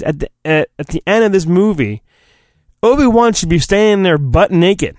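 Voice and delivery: normal talking voice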